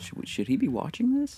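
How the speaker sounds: high, soft worried parent. Retold or imitated. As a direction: ask it like a fretting mom. Joins in as parent.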